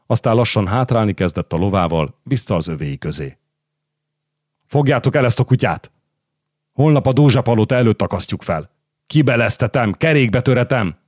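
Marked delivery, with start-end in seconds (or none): 4.7-10.9 higher-pitched angry threats; enraged order. Acted. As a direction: order the arrest in a temper. Enraged commander orders his enemy seized.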